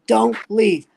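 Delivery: mock crowd plea, loud and high; crowd calling out. Retold crowd calling to the comic.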